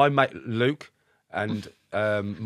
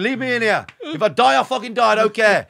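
loud, high, reckless yelling; defiant shout; 11 semitones higher, 10 dB louder than usual. Retold or imitated. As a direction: shout them off, fearless. Defiant retort to lads from sea.

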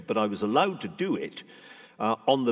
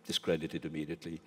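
softer repeat of own words; a quieter, musing echo; 3 semitones lower, 7 dB quieter than usual. Real, not acted. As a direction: say it again softly to yourself. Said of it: "Well, discredited immediately".

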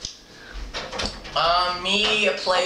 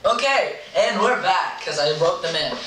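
ordinary talk

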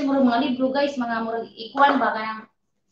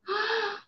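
sharp, high gasp of delight; excited gasp; 10 semitones higher, 5 dB quieter than usual. Real, not acted. Vlogger opening the earbud case for viewers, excited gasp. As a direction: gasp as the lid opens.

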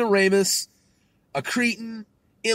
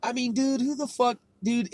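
ordinary talk, nothing marked

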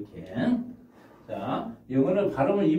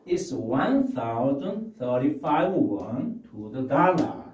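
very slow, clear numbers; slow model answer; much slower than usual. Shown as a demonstration. Teacher modelling the clerk's answer slowly. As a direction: count it out slowly. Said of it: "teacher models English answer".

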